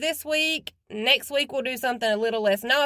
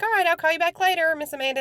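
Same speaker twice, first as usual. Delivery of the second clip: put-on little boy voice; 6 semitones higher, faster than usual. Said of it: "he's like".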